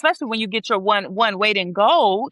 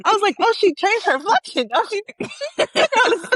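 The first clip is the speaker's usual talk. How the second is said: laughing while talking; 12 semitones higher than usual. Real, not acted. Friend apologizing while laughing hard at the story. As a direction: talk while cracking up. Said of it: "That's hella funny".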